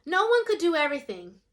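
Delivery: usual speaking voice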